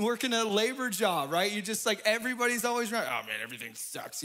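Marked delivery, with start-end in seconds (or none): 3.1-4.2 low, soft mock complaint; grumbling worker. Imitating coworkers at a labor job, low mock grumble. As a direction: sag into a lightly voiced gripe.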